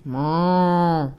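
lowing moo; cow moo. Acted. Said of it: "All she heard was".

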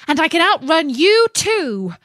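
high, loud boast; boastful taunt. A narrator's reading. Gingerbread man boasts to wolf.

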